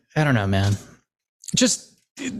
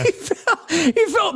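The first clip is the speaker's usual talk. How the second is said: laughing; 11 semitones higher, slower than usual. Real, not acted. Laughing retelling of fall.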